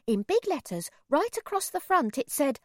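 plain reading voice

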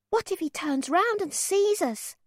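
put-on high child voice, a little worried; a boy frets under the umbrella; child's anxious question. A narrator's reading. Boy asks his mother, high and anxious. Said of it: "I asked".

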